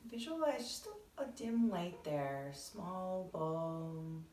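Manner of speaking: soft, measured meditation voice; guided meditation. Acted. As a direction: ease listeners gently into breathing, unhurried.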